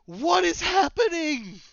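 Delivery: high, loud gushing disbelief; excited. Retold or imitated. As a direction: squeal it out in wonder. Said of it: "It was just".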